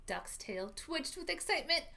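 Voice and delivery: ordinary delivery, nothing marked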